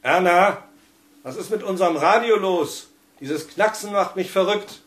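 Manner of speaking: husband fretting loudly at his wife; Felix's voice. A narrator's reading. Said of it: "Dann hörte ich Felix Seligs Stimme".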